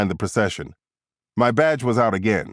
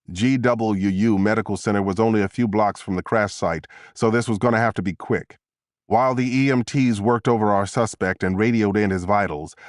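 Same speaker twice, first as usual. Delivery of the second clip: usual speaking voice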